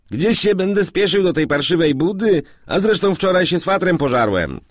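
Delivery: high, loud, indignant retort; irritated outburst. A narrator's reading. Man refuses to rush to work after quarrel, irritated outburst. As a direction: protest loudly in a high put-on voice.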